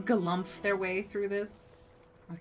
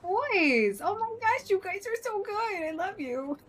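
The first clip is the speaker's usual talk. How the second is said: high, gushing praise for the pets; excited; 13 semitones higher, 3 dB louder, slower than usual. Real, not acted. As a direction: squeal with delight at them.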